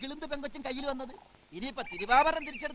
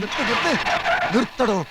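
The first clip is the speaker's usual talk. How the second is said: loud demand to halt; urgent shouted order; 3 semitones lower, 11 dB louder than usual. Acted. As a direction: yell for an immediate stop. Acted shout to make someone stop.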